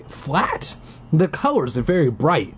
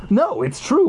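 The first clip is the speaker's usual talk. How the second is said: insisting; 9 semitones higher than usual. A narrator's reading. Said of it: "Wally insists".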